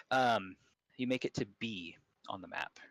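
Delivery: ordinary talk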